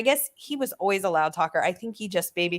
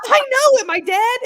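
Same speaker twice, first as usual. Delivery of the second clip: much higher and louder yell; panicked yell; 8 dB louder than usual. Retold or imitated. Dad shouting back at mom in panic. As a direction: shout it, scared and confused. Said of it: "He's like".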